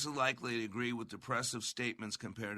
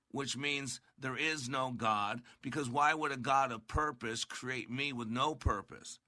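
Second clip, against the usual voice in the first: normal talking voice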